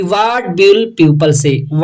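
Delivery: very slow, word by word English read. Shown as a demonstration. Teacher reading the English sentence slowly. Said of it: "teacher reads English line".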